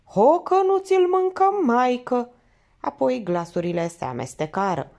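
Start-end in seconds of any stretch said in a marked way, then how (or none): 0.0-2.3 put-on old village woman calling out, higher and louder; cracked old woman's voice. A narrator's reading. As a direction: shout it like a worn-out granny. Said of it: "o voce spartă asigurând".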